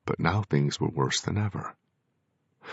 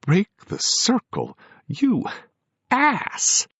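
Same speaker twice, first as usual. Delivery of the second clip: high, loud, slowly building outrage; incensed; 4 dB louder, slower than usual. A narrator's reading. Daughter furious at the medium, loud and climbing. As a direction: spit the insult at him.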